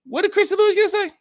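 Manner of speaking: very high, loud incredulous squeak; hammed-up falsetto disbelief; mock disbelief. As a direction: squeal the question in pretend shock. Mock disbelief at viewer's question.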